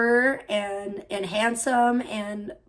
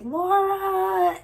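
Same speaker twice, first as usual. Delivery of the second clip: high, far-off call of a name; mock scream; 9 semitones higher than usual. Mother's voice echoing from the house, a mock scream. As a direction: call out like an echo from the past. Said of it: "screaming Laura's name".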